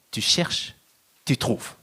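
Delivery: ordinary delivery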